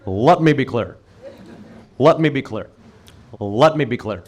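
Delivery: low, measured presidential catchphrase; Barack Obama. Acted. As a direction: imitate a politician, low, pausing between repeats. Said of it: "he would always use that phrase".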